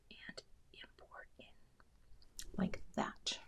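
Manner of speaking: very soft whisper while concentrating; real involuntary whisper. Real, not acted. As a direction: whisper under your breath as you work. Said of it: "Sometimes I whisper when I concentrate".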